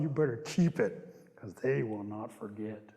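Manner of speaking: slow, low, deliberate caution; pointed warning. Real, not acted. Storyteller advising young boys about promises, pointed warning. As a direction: spell it out gravely for the listeners.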